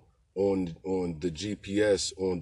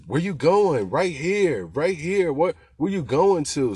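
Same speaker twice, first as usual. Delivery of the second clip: high, loud, pushy shouting; yelling directions; 9 semitones higher, 8 dB louder, slower than usual. Retold or imitated. TG barking directions at driver.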